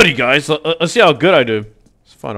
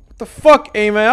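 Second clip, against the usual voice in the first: ordinary talk, nothing marked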